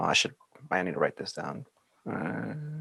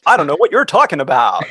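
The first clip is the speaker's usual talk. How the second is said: mock innocent podcast voice; 4 semitones higher, 6 dB louder, faster than usual. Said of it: "I'm like".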